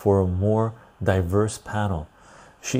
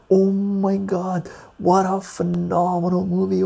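hammed-up delight at a great movie; ecstatic raving; 10 semitones higher than usual. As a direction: gush like a thrilled fan, pitched way up. Imitating own ecstatic reaction.

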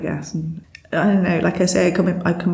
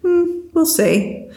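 higher, slow, unbothered we'll see; drawn out like a wise old farmer who shrugs it off; lightly voiced serene farmer; calm farmer; 11 semitones higher, slower than usual. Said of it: "the farmer kind of goes".

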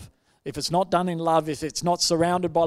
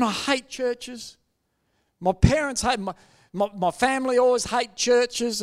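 mildly heated, higher-pitched rant; letting rip; 7 semitones higher, 4 dB louder than usual. Retold or imitated. Angry visitor complaining about churches, retold higher and heated. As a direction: retell a rant, pitched up, punching the words. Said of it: "really let rip".

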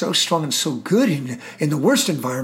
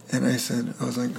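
usual speaking voice

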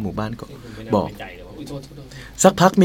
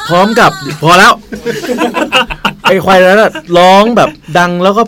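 laughing; 6 semitones higher, 6 dB louder than usual. Real, not acted. Laughing at own telling.

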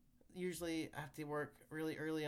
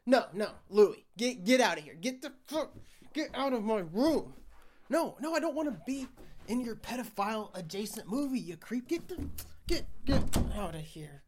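shouting; 6 semitones higher, 10 dB louder than usual. Acted. Cold open shouting at intruder.

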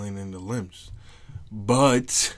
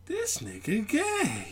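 drawn-out squeal, far above usual pitch; high-pitched teasing; 13 semitones higher, much slower than usual. Pretend. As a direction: tease in a slow, high mock voice. Mocking the brother, then laughing.

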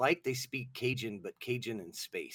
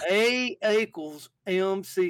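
loud, slow, high Southern drawl; mock Southern accent; 8 semitones higher, 9 dB louder, slower than usual. Scientist reciting a formula, slow exaggerated Southern accent. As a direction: drawl it out as a hammed-up Southern genius. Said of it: "didn't have Southern accents".

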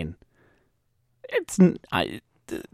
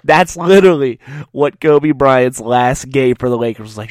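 ordinary talk

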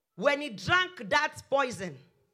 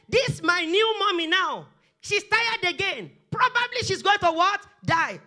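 put-on loud, higher-pitched outburst of a fed-up boy; raised voice, imitating a boy who snapped; retold snapping; 6 semitones higher, 5 dB louder than usual. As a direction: snap it out, acting the exasperated child. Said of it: "he snapped. He said".

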